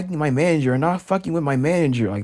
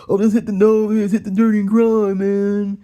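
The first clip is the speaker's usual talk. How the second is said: loud, high monotone imitation; hammed-up mocking impression; mocking put-on voice; 6 semitones higher, 4 dB louder than usual. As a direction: mimic someone's dumb advice, high and loud. Mimics someone lecturing him.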